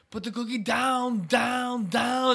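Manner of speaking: high mock action-star line, repeating like a remix; looping remix. Host imitating the YouTube remix, high repeating echo. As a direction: chant the last word as an echoing loop. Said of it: "It was, like, a remix".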